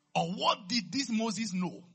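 high, loud, slowing for weight; forceful pulpit question; impassioned preaching. Real, not acted. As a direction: drive the question home to the congregation.

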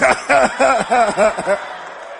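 long, rolling belly laugh; hearty laugh. Real, not acted. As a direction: break off mid-thought into sustained laughter. Laughs mid-sentence at own joke.

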